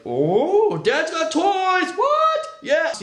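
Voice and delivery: very high, slow, squealing delight; thrilled kids. Retold or imitated. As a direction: gasp with excitement like a child hearing toys. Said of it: "They're like".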